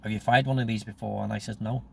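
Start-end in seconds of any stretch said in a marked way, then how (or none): none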